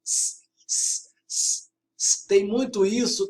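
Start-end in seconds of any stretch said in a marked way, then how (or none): 0.0-2.3 "S" as a sustained voiceless hiss, repeated; sibilant S. Shown as a demonstration. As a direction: hiss each "s" separately, with no vowel. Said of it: "sons que se repetem". ("s" sibilado e repetido, sem vogal)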